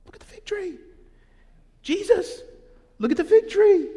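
high, rising to a loud amazed cry; high excited Peter voice. Retold or imitated. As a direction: gawk at the fig tree like a wide-eyed disciple. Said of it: "Peter's like".

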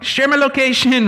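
high chirpy share my location; playful high phone voice; mock phone voice. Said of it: "it's like on your phone".

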